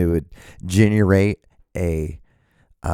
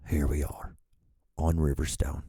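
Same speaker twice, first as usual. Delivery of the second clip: deep TV trailer voice; 4 semitones lower, 8 dB quieter than usual. Pretend. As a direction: lean into a mock show intro. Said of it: "could just get a little deeper".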